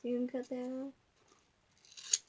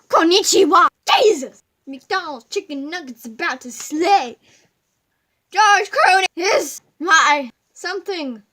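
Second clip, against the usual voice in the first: loud, higher nonsense yelling; silly shouting; 4 semitones higher, 14 dB louder than usual. Acted. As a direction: blurt random words at full volume. Random loud silly shouting bit.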